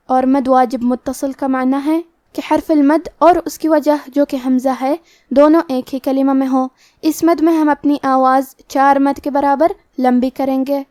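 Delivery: ordinary talk, nothing marked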